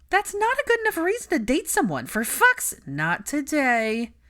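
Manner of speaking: high, loud outburst that gets cut off; she's about to explode at her brother; tipsy rant. A narrator's reading. Ginny starts ranting, gets cut off.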